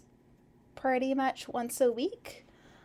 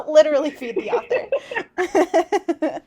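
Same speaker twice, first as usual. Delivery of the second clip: loud, slow, laughing through the words; can barely get it out for laughing; 4 semitones higher, 8 dB louder, slower than usual. Real, not acted. Cracking up while telling viewers to feed the author. Joking about sending food.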